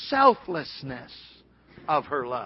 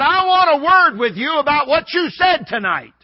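loud, high-pitched challenge; confrontational demand; 8 semitones higher, 8 dB louder than usual. Retold or imitated. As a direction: demand a word, imitating an aggrieved man. Man confronts the preacher after service.

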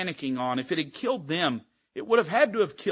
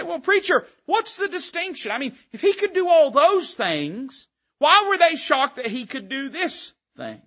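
high, questioning voice of a doubter; mock skeptical listener; 10 semitones higher, 4 dB louder than usual. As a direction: play the puzzled listener asking the preacher.

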